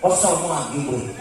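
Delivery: ordinary delivery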